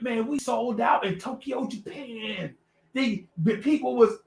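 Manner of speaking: mock a musician defending his glory days; defensive boasting. Old musicians boast back.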